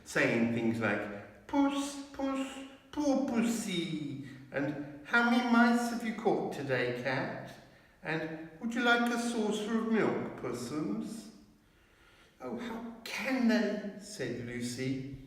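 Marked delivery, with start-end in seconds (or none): 1.5-11.3 high mocking sing-song; much higher sneering baby-talk; jeering creatures. A narrator's reading. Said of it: "jeering at him". 12.4-13.9 much higher, tearful Lucy; a girl close to crying. A narrator's reading. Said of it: "tears streaming down her cheeks".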